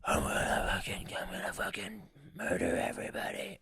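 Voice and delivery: hushed, rasping demon threat; soft, growly possessing demon. Acted. Demon threatening from inside his head, menacing rasp. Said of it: "the demon would be over here like".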